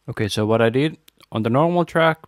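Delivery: ordinary talk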